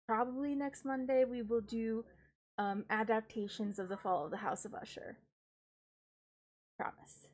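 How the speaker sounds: ordinary talk, nothing marked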